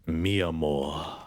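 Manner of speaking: slow, drawn-out mock French accent; fake French accent. Streamer searching for what French sounds like, fake French accent. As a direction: try out a Romance-language phrase as bad French. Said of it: "what's, what's French".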